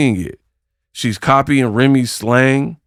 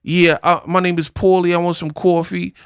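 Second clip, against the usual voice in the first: mock New York accent, pitched higher; Clarissa Shields's New York accent; 5 semitones higher than usual. Vlogger mimicking a boxer's sudden New York accent, mocking higher-pitched impression. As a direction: exaggerate a borrowed New York accent in mockery. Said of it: "how do you have this accent where she's like".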